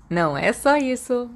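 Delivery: usual speaking voice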